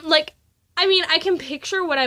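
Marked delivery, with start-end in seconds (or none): none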